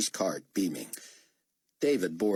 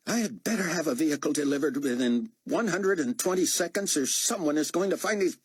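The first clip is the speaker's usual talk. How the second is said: sharp, raised bark into the phone, higher; barked order; 8 semitones higher than usual. A narrator's reading. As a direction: snap the order at the motor pool. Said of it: "finally barked at the motor pool supervisor".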